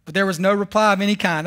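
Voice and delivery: ordinary talk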